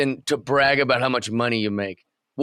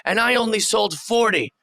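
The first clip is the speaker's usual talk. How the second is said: mock yell, pitched way up; Kevin Owens; 8 semitones higher than usual. Wrestler complaining about unsold merch, imitated yelling. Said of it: "when he's just yelling".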